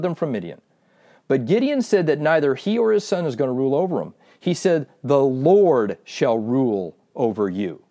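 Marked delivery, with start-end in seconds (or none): 5.0-7.8 slow, weighty declaration, a little lower; solemn quoted declaration. Retold or imitated. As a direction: spell it out slowly as a solemn pronouncement. Solemn quote of Gideon's refusal.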